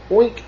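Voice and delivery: high, quick oink; a short high piggy oink. A narrator's reading. Piggy greeting Blue, quick high oink. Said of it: "said a piggy".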